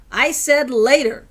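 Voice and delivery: loud, slow, biting; angry snap. A narrator's reading. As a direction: cut her off sharply. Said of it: "He was now angry at her".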